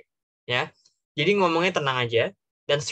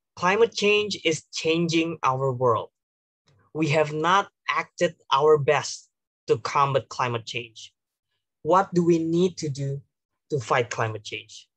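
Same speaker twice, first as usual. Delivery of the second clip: slow, deliberate conference speech; engaging speech opening; slower than usual. Shown as a demonstration. Delegate opening a conference speech to grab attention, slow and engaging. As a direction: open the speech slowly, as if to make the whole room listen. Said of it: "Tapi bikin yang engaging".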